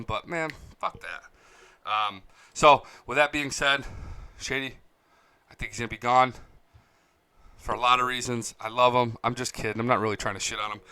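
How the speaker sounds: normal talking voice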